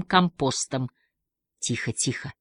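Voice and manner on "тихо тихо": softer murmur to calm him; soothing murmur. Acted. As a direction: hush him gently. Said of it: "бормотала она". (тихое успокаивающее бормотание)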